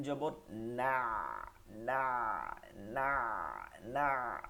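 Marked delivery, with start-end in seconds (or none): 1.7-4.4 না with the ayn sunk in mid-throat; deep ayn. Shown as a demonstration. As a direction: let না settle into the middle of the throat like mud. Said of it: "বসে যাবে কাদার মতন".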